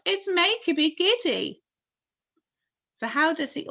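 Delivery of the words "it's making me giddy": high loud plea; pleading call. A narrator's reading. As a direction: call out for help. Miss Spider complains.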